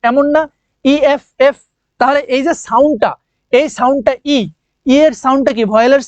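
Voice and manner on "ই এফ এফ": "ই এফ এফ" said as a clear "e-f" with the vowel first; spelled-out pronunciation. Shown as a demonstration. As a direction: sound out "ই এফ এফ" slowly, stressing the opening e. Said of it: "এফ কে তুমি যদি উচ্চারণ করো".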